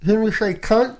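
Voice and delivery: pen held in mouth; pen in teeth. Real, not acted. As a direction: talk through a pen between teeth. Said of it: "I'll put my pen in my mouth".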